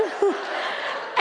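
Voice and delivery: a quick airy laugh at her own story; breathy laugh. Real, not acted. Laughing as she tells the crowd about Julie's rescue. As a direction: let a soft laugh slip out.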